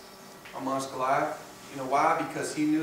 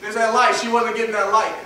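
loud, raised pulpit declaration; fired-up preaching; 5 semitones higher, 9 dB louder than usual. Acted. Preacher telling the church we are the light, fired-up preaching. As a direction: proclaim it high and loud.

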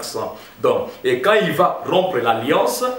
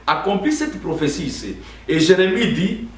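ordinary talk

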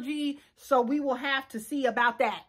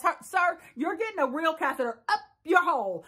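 ordinary talk, nothing marked

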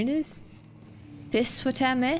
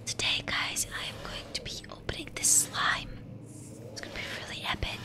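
soft unvoiced mock streamer whisper; whispering imitation; 9 dB quieter than usual. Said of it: "That's what he sounds like".

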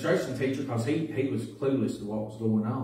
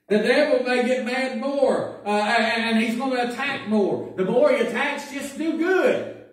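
preaching; 10 semitones higher, 5 dB louder than usual. Acted. Preacher warning his flock about the devil, loud and high. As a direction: lift it up and drive it home like a preacher.

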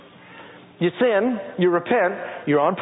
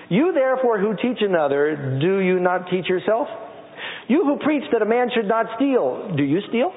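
raised, ringing scripture read; 5 semitones higher than usual. Acted. Preacher reading Romans to the church, raised and ringing. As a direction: proclaim it to the congregation.

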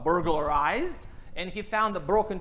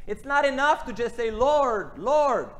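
forceful, raised preaching voice; preaching loud; 6 semitones higher, 8 dB louder than usual. Real, not acted. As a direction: drive home the empty "Lord, Lord". Preacher stressing empty worship.

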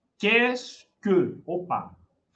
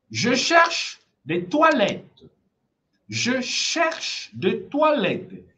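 slow teacher model; 3 semitones higher, slower than usual. Shown as a demonstration. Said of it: "teacher model, slow".

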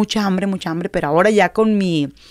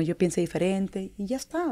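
normal talking voice

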